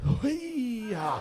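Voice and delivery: high mock yelp of a stomach cramp. Comic imitates his stomach cramp on the bus, yelping. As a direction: squeal like your gut just seized. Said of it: "like a stomach cramp goes".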